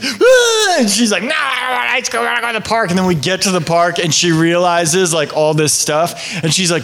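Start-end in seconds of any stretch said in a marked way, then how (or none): 0.0-0.8 high laughing mock shriek of a little girl; toddler shriek. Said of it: "it's almost like this". 1.2-2.9 put-on sulky child voice. Daughter refusing the ice cream.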